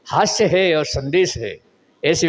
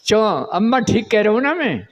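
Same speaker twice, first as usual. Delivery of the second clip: usual speaking voice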